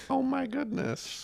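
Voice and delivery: high, slow mock old-lady voice; dazed old woman.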